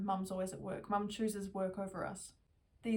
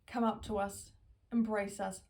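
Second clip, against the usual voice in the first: ordinary talk, nothing marked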